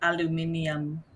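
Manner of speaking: put-on aluminium in a heavy Serbian accent. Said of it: "in, like, a heavy Serbian accent".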